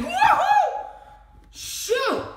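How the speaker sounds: very high, loud whoop; real joyful whoop; excited whoop. Real, not acted. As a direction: cheer like you just won. Celebrating unboxing.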